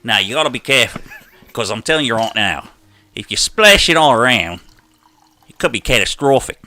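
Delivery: broad Aussie demonstration; Australian accent. Shown as a demonstration. Host warning about spilt coffee, Australian accent. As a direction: spin a warning in an Australian twang. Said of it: "do my Australian accent".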